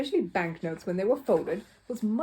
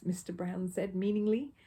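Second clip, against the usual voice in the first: ordinary delivery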